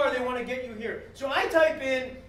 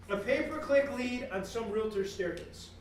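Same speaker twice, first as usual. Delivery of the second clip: ordinary talk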